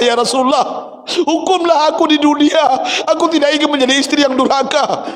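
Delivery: high, pleading, tearful cry; high anguished wife pleading; Aisyah weeping. Acted. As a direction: beg for punishment through tears. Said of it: "apa jawaban Aisyah".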